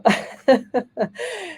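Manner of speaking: real giggle breaking out, high; high amused laugh; high burst of laughter. Real, not acted.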